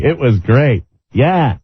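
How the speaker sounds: ordinary talk, nothing marked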